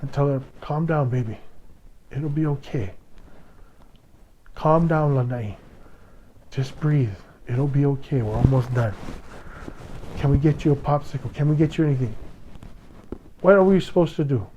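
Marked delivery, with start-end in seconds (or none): 0.6-12.2 softer, gentle hushing reassurance; soothing a child. Retold or imitated. Parents soothing daughter after dental surgery, soft and tender. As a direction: ease a scared little girl down softly. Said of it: "we have to calm her down".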